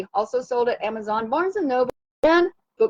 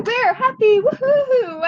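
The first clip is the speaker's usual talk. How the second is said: cheering whoop; 10 semitones higher, slower than usual. Real, not acted. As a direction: throw it up like a cheerleader. Cheer climax of the chant.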